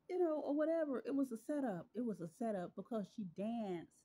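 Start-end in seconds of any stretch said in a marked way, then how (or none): 0.1-1.9 high, loud and indignant; exclaimed indignation. Real, not acted. Telling followers about the gas station, high and indignant. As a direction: it was a setup, say it up high and loud.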